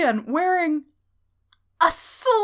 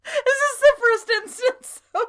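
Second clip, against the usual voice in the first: high voice, barely holding back laughs; giddy with laughter; 11 semitones higher than usual. Real, not acted. Co-host asking about the insult, giddy with laughter. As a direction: squeeze the question out through giggles. Still cracking up over the insult.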